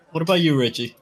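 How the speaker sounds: ordinary talk, nothing marked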